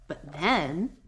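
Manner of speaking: usual speaking voice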